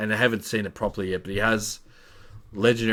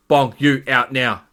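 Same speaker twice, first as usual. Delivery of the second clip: loud, raised retold command; stern order; 5 semitones higher, 9 dB louder than usual. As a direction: order them out, firm and loud. Fleur orders Bong out.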